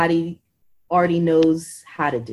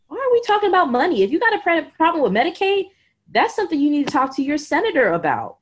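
high, fast, incredulous retort to the doctor; a fed-up voice asking why money comes up at all; exasperated retort; 8 semitones higher, faster than usual. Retold or imitated. Patient retorting to doctor over money, exasperated and high.

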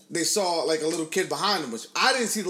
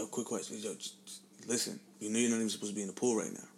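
low, very soft retold confidence; imitating a friend's confidential murmur; hushed warning; 7 semitones lower, 9 dB quieter than usual. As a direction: break awkward news discreetly, under the breath. Friend discreetly warns him.

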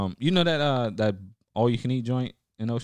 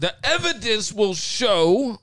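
slow, high, louder lawyer voice through laughter; mock lawyer voice; 9 semitones higher, 8 dB louder, slower than usual. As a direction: play a pompous prosecutor, laughing. Mock courtroom statement.

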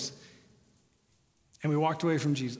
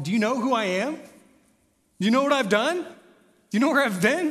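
incredulous; 6 semitones higher, 4 dB louder than usual. Pretend. Sinner doubting God's welcome.

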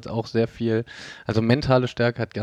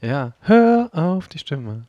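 slow, high, drawn-out voice; mysterious inner voice; 5 semitones higher, 4 dB louder, much slower than usual. Pretend. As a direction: intone it like a voice in your head. Echoing the phrase as inner voice.

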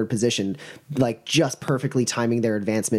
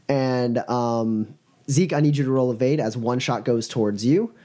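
normal talking voice

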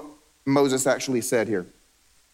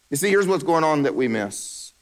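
ordinary delivery

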